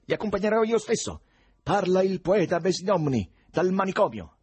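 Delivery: acuto, forte, concitato; grido al telefono. A narrator's reading. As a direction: pretendi aiuto gridando nella cornetta. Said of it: "gridò Ivan al telefono". (gridato, perentorio)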